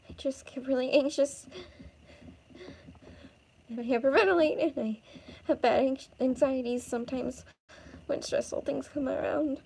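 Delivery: high, breathless anxious confession; anxious, breathing heavily. Acted. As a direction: confess haltingly between heavy breaths. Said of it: "You're breathing pretty heavily".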